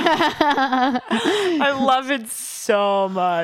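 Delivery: slow words through real laughter; giggling delight; laughing. Real, not acted. Laughing while saying it.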